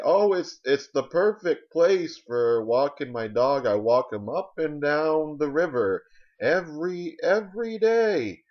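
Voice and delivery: put-on loud, high, drawn-out old-timer voice; hammed-up old dog owner; old person. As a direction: ramble fondly like an elderly survey respondent. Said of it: "Old people love to do phone surveys".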